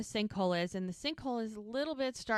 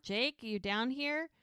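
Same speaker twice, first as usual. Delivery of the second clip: higher-pitched, restrained retold yell; mock calling out; 6 semitones higher than usual. Rescuer calling down for a trapped boy, mildly mock yell. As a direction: call down the hole without really yelling. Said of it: "he starts yelling out".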